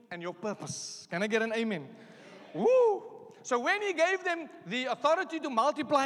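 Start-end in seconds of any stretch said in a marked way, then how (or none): 2.5-3.0 high shouted woo; loud whoop. Real, not acted. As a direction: let out a joyful whoop. Preacher whoops to congregation.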